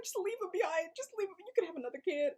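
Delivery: high, softening squeal of exasperation; exasperated plea. Retold or imitated. Viewer begging the dad to ditch his son, exasperated plea. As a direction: plead it high, then let it drop. Reacting to the film kid, exasperated.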